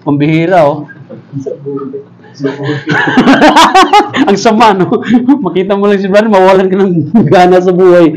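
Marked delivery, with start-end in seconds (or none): 2.4-4.2 high rising burst of laughter; amused laugh. Real, not acted. Pastor amused by his own example, real laughing. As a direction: laugh out at your own joke.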